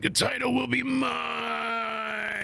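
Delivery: high, slow, strained dramatic vow; slow, cracked scream-whisper of a title threat; menacing vow. Retold or imitated. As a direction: draw out each word like a movie villain. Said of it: "but she's like".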